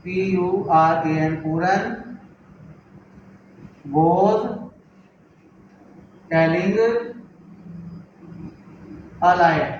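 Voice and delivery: word by word with long pauses; spacing words out for students to write; slow dictation. Shown as a demonstration. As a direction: dictate each word separately for writing. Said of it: "sentence number four".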